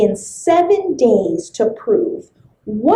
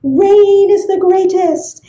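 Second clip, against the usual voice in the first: high loud cheer; exclaiming; 8 semitones higher, 4 dB louder than usual. Acted. Wind exclaiming rain is greatest, high cheer. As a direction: proclaim it triumphantly.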